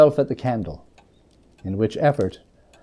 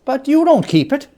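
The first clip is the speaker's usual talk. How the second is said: bright retort; 12 semitones higher, 6 dB louder than usual. A narrator's reading. Nephew's gentle rebuke.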